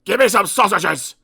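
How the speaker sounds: loud, rude order; snapped. Acted. Mister Grumpy ordering the butcher about, loud and rude. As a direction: bark at the butcher. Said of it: "snapped Mister Grumpy".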